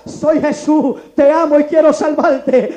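loud, slow proclamation. Acted. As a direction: voice of God in full preaching force. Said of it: "por segunda vez viene más fuerte ahora".

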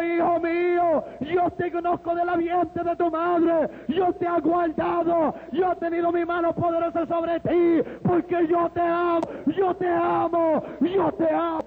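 slow, drawn-out proclamation; voice of God. Retold or imitated. God telling his son he loves him, slow and fervent. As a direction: declare each phrase with fervent weight. God declares love in the vision.

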